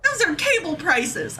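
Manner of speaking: high-pitched, indignant rant with stretched-out words; exasperated outburst. Real, not acted. Ranting to chat about subscription prices, high exasperated outburst.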